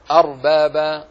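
"أرباب" with a full, heavy ر, loud. Shown as a demonstration. Teacher giving students an example, "أرباب" with heavy ر. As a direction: thicken the silent-vowel ر in "أرباب". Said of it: "تفخم".

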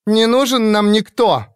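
high and loud flare-up; indignant outburst. A narrator's reading. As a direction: burst out in defiance. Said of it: "встрепенулась Шейден". (вспыльчиво и громко)

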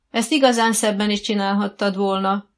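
narrated blaring voice, moderately loud; booming criticism. A narrator's reading. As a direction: call out the criticism across the arena. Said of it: "harsant Jutka néni hangja".

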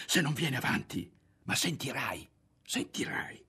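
tense whisper, fading at the end. A narrator's reading. Berto warning Ario, in a tense whisper.

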